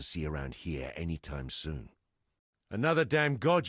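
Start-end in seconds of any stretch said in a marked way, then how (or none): 2.7-3.7 higher and louder, incredulous; exasperated disbelief. Acted. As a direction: react like this is the last thing you needed. Hears of another god, exasperated.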